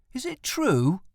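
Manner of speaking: put-on high, curious boy asking; boy's voice. A narrator's reading. Tim asks his dad at supper, high and eager. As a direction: voice young Tim lightly, eager.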